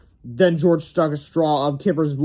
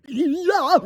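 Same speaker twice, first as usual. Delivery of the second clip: put-on much higher kid shout; excited cry; 13 semitones higher, 3 dB louder than usual. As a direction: back up his friend eagerly. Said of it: "cried Harold".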